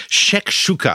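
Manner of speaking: Shakshuka sung out high, each syllable savoured; relishing the name. Real, not acted. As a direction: pitch Shakshuka up and taste the word. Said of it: "I love the name".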